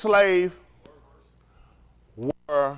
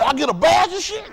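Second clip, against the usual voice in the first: higher, excited shout; eager deputy; 9 semitones higher, 4 dB louder than usual. Acted. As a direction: jump at the chance. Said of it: "He said".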